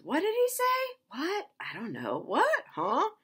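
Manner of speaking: high, loud baffled what; high, loud bewildered onlooker; baffled listener. Retold or imitated. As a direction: squawk confused questions. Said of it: "this guy says".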